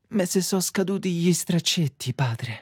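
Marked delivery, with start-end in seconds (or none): none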